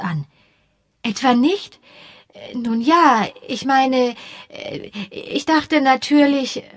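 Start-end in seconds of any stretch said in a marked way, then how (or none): 1.0-6.6 higher, hesitant and slow at the end; lightly voiced young woman, embarrassed; flustered young woman. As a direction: stumble over the words, caught out. Josie flustered, read aloud.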